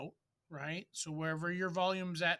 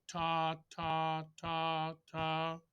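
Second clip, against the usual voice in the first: "ta" evenly tongued on one level pitch; long, connected "ta" notes with just a slight space between; tongue each "ta" evenly, without any extra punch; regular tonguing; much slower than usual. Shown as a demonstration. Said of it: "should be tongued".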